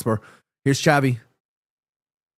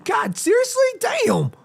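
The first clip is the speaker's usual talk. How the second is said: surprised; slower than usual. Real, not acted. Reacts to bad league stat.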